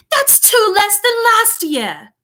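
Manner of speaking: high, slow British brat complaint; indignant spoiled child in a British accent; spoiled boy. A narrator's reading. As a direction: whine it high and drawn out. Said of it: "I'm doing a British accent".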